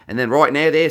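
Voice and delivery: put-on Australian drifting British; slipping into British. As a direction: let the Aussie accent slide into British. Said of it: "I just dipped into a bit of British".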